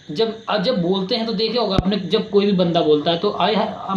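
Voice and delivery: ordinary talk, nothing marked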